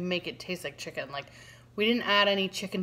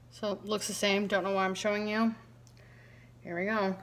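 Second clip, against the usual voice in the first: ordinary talk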